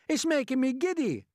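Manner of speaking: high, loud complaint; calling out. A narrator's reading. Miss Spider dizzy atop the peach, calling out. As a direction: call down plaintively. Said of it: "Miss Spider called out".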